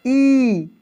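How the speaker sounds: emphatic ई; forceful ई. Shown as a demonstration. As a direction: press hard on ई. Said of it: "जोर लाके ई".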